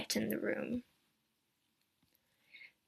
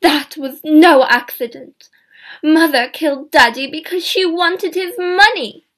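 higher, loud accusing voice; angry outcry; 6 semitones higher, 11 dB louder than usual. Acted. Diane accuses her dead mother, loud, high and angry. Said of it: "Diane cried".

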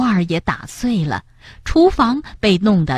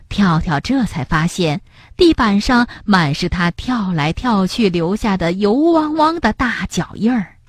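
ordinary delivery

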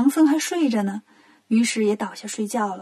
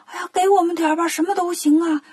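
quick, higher-pitched pleading; eager begging; 5 semitones higher, faster than usual. Acted. 大伙儿求神仙赐圣水, fast eager begging. Crowd begs the gods eagerly.

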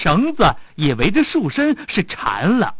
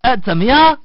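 high, louder, teasing call; taunting musician; 7 semitones higher, 4 dB louder than usual. Acted. Musician leaves the tied fox waiting, taunting. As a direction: call it up high and pleased with the trick.

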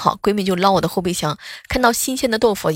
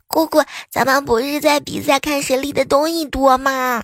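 put-on higher, innocent child's voice; little niece; 6 semitones higher than usual. Niece telling her aunt about the tack, a higher childlike voice. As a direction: sound like a sweet little girl explaining.

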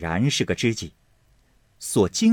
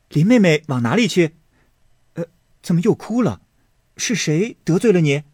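put-on louder, teasing young man's call; Baoyu, eager young man; 8 semitones higher, 5 dB louder, faster than usual. Baoyu calling after weeping cousin, eager and louder. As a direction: chase after her and coax her. Baoyu voiced chasing Daiyu.

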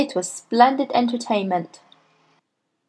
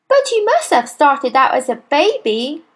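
put-on brave little boy; 6 semitones higher, 4 dB louder than usual. A narrator's reading.

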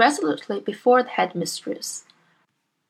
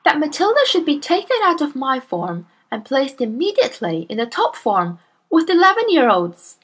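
put-on higher, earnest young teacher voice; Miss Honey; 6 semitones higher, 4 dB louder than usual. Young teacher protests to her headmistress, earnest and higher. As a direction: politely protest to a bully.

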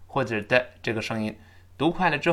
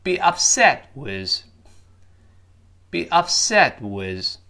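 be upset with, slow with SET stressed; slow model reading; much slower than usual. Shown as a demonstration. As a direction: read be upset with slowly for learners. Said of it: "我们读一下这个搭配". (慢速示范读be upset with)

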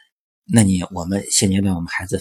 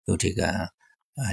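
usual speaking voice

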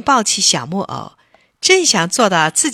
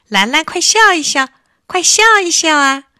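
higher and louder eager coaxing; 9 semitones higher, 7 dB louder than usual. Acted. Tingting urges Lanlan to smile, eager and louder. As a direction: brightly urge her to smile.

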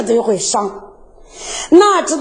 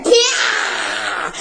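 a sharp shouted slap sound effect; 6 semitones lower than usual. Acted. Slap in the story. (模仿响亮的一巴掌声)

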